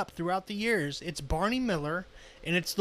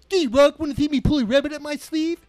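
high, loud, quick cartoon moose; Bullwinkle voice; 7 semitones higher, 9 dB louder than usual. Retelling the cartoon moose bit to the hosts, loud and high. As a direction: rattle it off as a goofy cartoon moose. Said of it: "good Bullwinkle impression".

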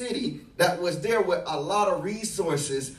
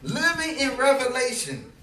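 rising, louder preacher's climax; preaching at full force; 4 semitones higher, 5 dB louder than usual. Acted. Preacher rallying the congregation toward their calling, at full force. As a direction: drive it home to the congregation.

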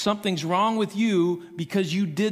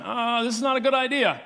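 higher, fretful voice of the man on the mat; nervous aside from a man being lowered; worried paralyzed man; 5 semitones higher than usual. Retold or imitated. Paralyzed man fretting to himself, higher nervous voice. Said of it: "the guy on the mat going".

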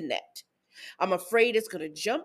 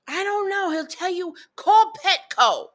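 put-on high, drawn-out kid voice on call Petco; smart-mouthed kid; 9 semitones higher, 6 dB louder than usual. Daughter telling mom to call Petco, high and slow. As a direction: stretch out call Petco like a know-it-all kid.

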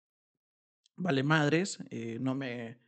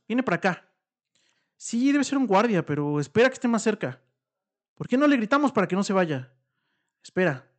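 higher and louder, eager to be rescued; urgent and hopeful, voices rising; excited hope; 6 semitones higher, 8 dB louder than usual. Acted. Couple hearing someone approach their trap, excited and loud.

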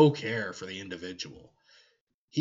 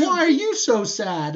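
mock sweet comforter; 11 semitones higher, 8 dB louder than usual. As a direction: coo it like a fake-kind friend. Erebus voiced consoling Lorgar.

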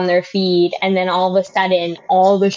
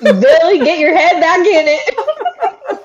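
mock scolding parent; 7 semitones higher, slower than usual.

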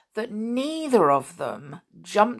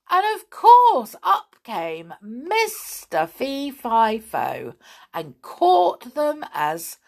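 plain reading voice

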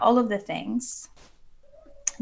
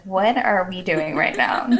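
louder, baffled question to the coach; retold bewilderment; 5 dB louder than usual. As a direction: play the lost, half-laughing client. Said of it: "I was like".

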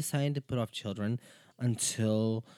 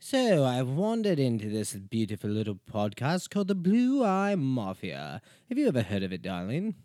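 Winston Churchill impression; 2 semitones higher, 7 dB louder than usual. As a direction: stretch Blue Eye Mafia grandly. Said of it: "as Winston Churchill".

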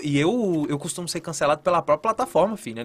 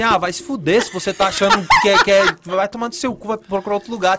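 mock rude shout; 3 semitones higher, 7 dB louder than usual. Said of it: "e manda bem assim".

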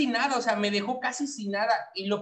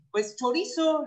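sheepish; 7 semitones higher than usual. Retold or imitated.

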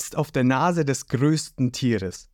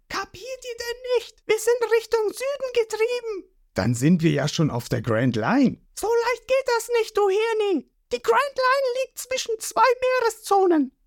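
Nami explaining. A narrator's reading. Nami lectures the crew.

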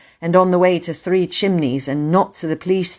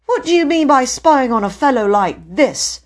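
put-on high, loud indignant boy; angry; 8 semitones higher, 5 dB louder than usual. A narrator's reading. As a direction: demand an explanation crossly. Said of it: "said Peter angrily".

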